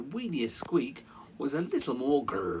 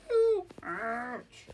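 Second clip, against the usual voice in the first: mock sympathy; 6 dB louder than usual. Reader reacts to mouse's troubles.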